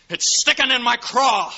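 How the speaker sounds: loud, high, bitter cry; bitter yell. Acted. George venting his bitterness at the town. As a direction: spit it out in disgust.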